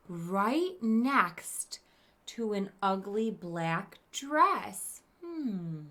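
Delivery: usual speaking voice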